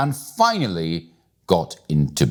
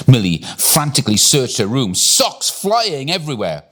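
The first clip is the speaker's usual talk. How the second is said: ordinary delivery, nothing marked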